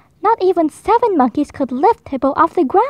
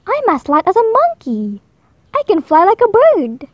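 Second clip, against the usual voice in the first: higher, joyful wonder; thrilled; 4 semitones higher than usual. Acted. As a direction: bubble over with delight. Hippo thrilled swimming.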